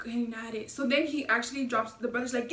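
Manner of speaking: ordinary talk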